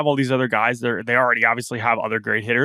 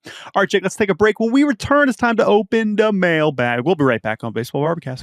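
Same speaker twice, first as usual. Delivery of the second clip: announcer voice; 7 semitones higher, 4 dB louder than usual. Acted. Announcer break tease.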